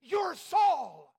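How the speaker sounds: high, loud accusing shout; crying out loudly. Acted. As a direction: shout it in shocked accusation, stretched out. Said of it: "cried out at the top of her voice".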